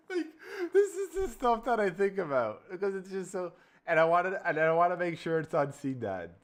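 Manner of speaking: words tumbling out between laughs; half-laughing, half-crying with delight; laughing while talking. Real, not acted. Laughing at the AI movie.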